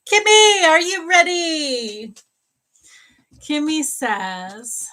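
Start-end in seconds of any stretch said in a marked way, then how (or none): 0.1-2.1 high, loud and drawn out; a gleeful squeal of a name; excited sing-song call. Real, not acted. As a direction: call her like an eager friend.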